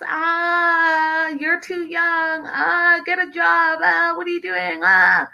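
high drawn-out mocking whine; mocking nag. Critics nagging about being too young, high mocking imitation.

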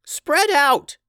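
high, loud shouted order; yelled command. Acted. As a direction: yell it to a scattered party. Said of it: "yelled Tubes".

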